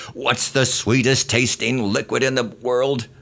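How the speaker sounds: high, loud barked drill sergeant question; drill sergeant scream. Retold or imitated. Drill sergeant screaming at recruits to motivate them, loud high yell. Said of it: "screamed at us to get us properly motivated".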